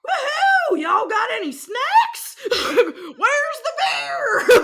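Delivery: high, loud whooping yell, laughing through it; a giddy loud whoop with stretched-out words; rowdy party entrance. Retold or imitated. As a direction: burst in hollering for snacks and beer. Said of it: "we busted right into that first venue and are like".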